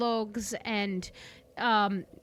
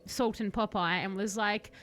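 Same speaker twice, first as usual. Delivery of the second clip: usual speaking voice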